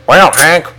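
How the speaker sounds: high, loud Southern gentleman caricature; hammed-up colonel voice, rising at the end; Colonel Sanders. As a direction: put on a showy character voice, punching the last words. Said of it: "like, Colonel Sanders' voice".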